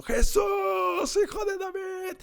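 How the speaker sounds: imitated shout, stretched and high; crying out. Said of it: "Cuando Bartimeo está gritando".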